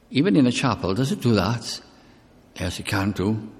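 usual speaking voice

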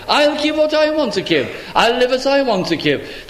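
high, mocking questions from the ungodly; voicing scoffers. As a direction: scoff at God as the proud would. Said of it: "this is how they talk".

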